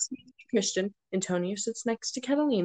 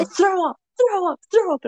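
loud, high mock chant; egging on; 11 semitones higher, 7 dB louder than usual. Friend goading her to throw up, loud high mock yelling.

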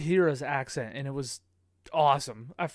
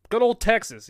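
hearty, louder, higher Texan boast; mock Texan pride; 7 semitones higher, 7 dB louder than usual. A hearty hint of a Texan, bragging about the roommate's home state. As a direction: play it up like a proud Texan.

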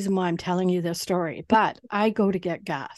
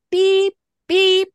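high, loud mock censor beeps standing in for curses; cartoon bleep over every curse word; bleeped swearing; 12 semitones higher, 8 dB louder than usual. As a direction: sing out beep beep in place of swearing. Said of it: "'cause I'm swearing the whole friggin' time".

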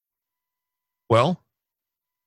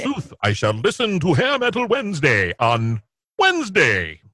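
high, archaic stage voice; hammy stage actor; 7 semitones higher than usual. Pretend. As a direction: ham it up like an old stage actor. Mock Shakespearean line.

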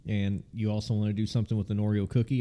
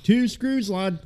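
put-on Scottish accent; 8 semitones higher, 5 dB louder, much slower than usual. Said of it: "supposed to be Scottish".

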